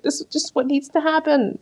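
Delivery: a hint of high, resigned girl; imitating Betty. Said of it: "And she's like".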